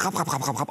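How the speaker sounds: rapid repeated gobbling noise; gobbling sound. Retold or imitated. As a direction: imitate a dog wolfing it down. Imitates old dog gobbling food. (schnelles, nachgeahmtes Schlinggeräusch)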